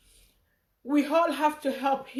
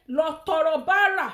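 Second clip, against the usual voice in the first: mock command; 3 semitones higher, 7 dB louder than usual. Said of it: "Tí wọ́n bá sọ fún mi".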